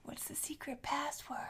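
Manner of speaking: unvoiced conspiratorial whisper. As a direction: whisper it like a secret. Asking a secret password.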